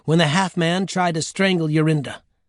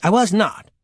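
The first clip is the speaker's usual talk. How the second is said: quick, higher-pitched indignant denial; snapping; 6 semitones higher than usual. Acted. Jorinda denying the grandmother's verdict, snapping. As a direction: snap back in protest. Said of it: "Jorinda snapped".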